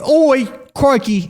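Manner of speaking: slow, high, loud "Oi, crikey"; hammed-up Australian exclamation; Aussie accent. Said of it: "she's British in your accent".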